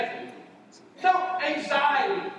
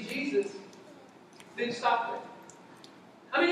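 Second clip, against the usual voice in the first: usual speaking voice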